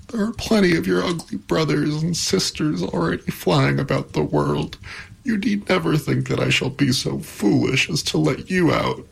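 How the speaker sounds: high, mildly tearful protest; starting to sob. A narrator's reading. Pandora telling the creature to stay inside, mildly tearful. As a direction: scold with a touch of tears. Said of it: "again beginning to sob".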